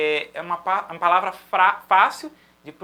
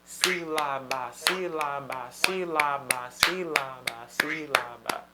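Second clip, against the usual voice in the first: "Sílaba" in slow, perfectly even syllables, low; sillabe di uguale durata; 5 semitones lower, 3 dB quieter, much slower than usual. Shown as a demonstration. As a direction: give all three syllables of "Sílaba" the same length, chanting slowly. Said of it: "cada nota durar o mesmo tempo".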